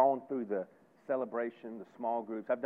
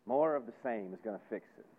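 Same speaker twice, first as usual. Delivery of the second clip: ordinary talk, nothing marked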